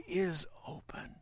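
sudden breathless hush; hushed awe at the open book; dramatic whisper. Acted. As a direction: drop to a whisper for effect.

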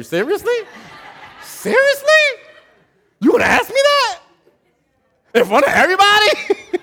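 very high, loud, shouted outrage; outraged congregant. Retold or imitated. Pastor playing a congregant outraged at being asked about sins, shrieking. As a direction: squeal in mock indignation.

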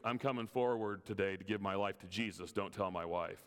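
lightly voiced husband, low and confidential; low, hushed husband confiding. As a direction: drop low and keep it secret. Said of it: "He goes".

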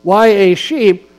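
preaching. Acted. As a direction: drive each phrase home to the pews. Preaches on Psalm 23.

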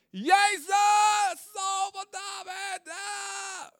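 high, desperate shout. Acted. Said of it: "hij riep des te luider".

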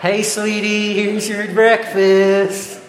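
laughing, high, drawn-out waitress voice; folksy diner waitress. Retold or imitated. Oregon diner waitress greets a customer, laughing and sing-song. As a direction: serve it up like a friendly small-town waitress.

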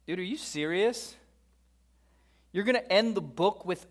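mock disbelief, pitch jumping up; comically dumbfounded; incredulous. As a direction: question it like an appalled buddy. Mock disbelief at the book's ending.

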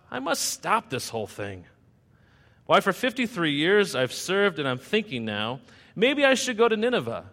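lively, sing-song storybook reading in Seuss rhythm; rhyming story narration. A narrator's reading. Preacher reading a rhyming Jonah tale to the church, sing-song storytelling. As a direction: tell it like a bedtime rhyme to the congregation.

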